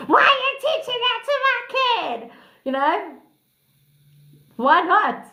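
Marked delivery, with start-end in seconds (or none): 0.1-2.3 high-pitched mock-scolding parent; outraged parent. Parent scolding the auntie who taught the niece, mock outrage. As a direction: imitate a scandalised parent in a high squawk. Voices disapproving parent in story.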